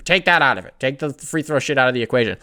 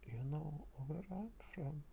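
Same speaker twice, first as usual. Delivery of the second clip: put-on Serbian-accented mumble, much softer; a quiet, mumbled Serbian accent; Nikola Jokic impression; 27 dB quieter than usual. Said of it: "I'm gonna do my best Nikola Jokic impression".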